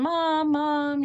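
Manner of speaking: high, carrying call for a lost mother; calling for mom. Retold or imitated. Lost child calling for mom in the store, high call. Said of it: "So I'm out there going".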